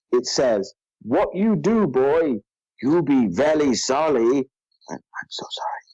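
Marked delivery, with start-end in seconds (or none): 1.0-4.5 mock Chinese accent, slow sing-song; caricatured pidgin Chinese-accented dragon; Lindfarn Worm. Reading the dragon's phonetically written dialogue aloud, mock Chinese accent. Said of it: "this is phonetically how it's written". 4.9-5.8 real hushed, voiceless apology; whispered apology. Real, not acted. Embarrassed apology after offensive accent.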